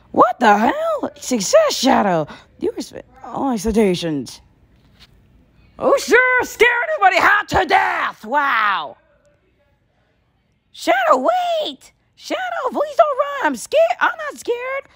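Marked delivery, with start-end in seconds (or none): 0.1-1.1 much higher, louder, slow outcry; stunned yell at the monster; shocked yell. Acted. 5.8-9.0 much higher, louder mocking yell in another voice; sarcastic shout. 10.7-14.9 put-on much higher girl's voice begging; a frightened young girl pleading him to stay; young girl begging Shadow not to run. Young Maria begs Shadow.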